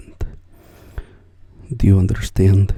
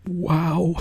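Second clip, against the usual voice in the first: high wow; 10 semitones higher than usual. Retold or imitated. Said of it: "I was like".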